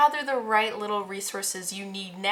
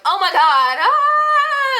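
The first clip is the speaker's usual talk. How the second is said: high, loud mock panicked wail; a hammed-up shriek of alarm; 11 dB louder, much slower than usual. To the pile, mocking their panic: high, loud mock wail. Cloud comes, panicked reaction.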